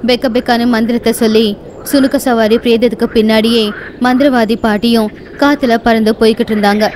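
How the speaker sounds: ordinary delivery, nothing marked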